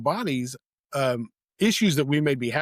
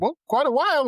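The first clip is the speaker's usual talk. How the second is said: sheepish answer; 12 semitones higher, 4 dB louder than usual. Retold or imitated. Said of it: "And I was like".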